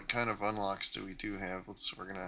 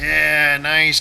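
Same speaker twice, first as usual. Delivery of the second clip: loud excited cheer; 5 semitones higher, 15 dB louder than usual. Real, not acted. Teammate scores kill nearby.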